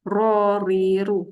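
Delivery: "Ro, ri, ru" with a rolled r, heavy on "Ro"; thick Arabic r, syllables held long; thickened ro. Shown as a demonstration. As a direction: roll the tongue tip and round out "Ro". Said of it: "Tebalkan sikit ro".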